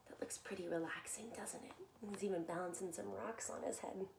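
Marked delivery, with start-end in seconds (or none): 0.1-1.7 whisper. Acted. Reader whispering to children about feeling like himself. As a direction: whisper it, calm and content.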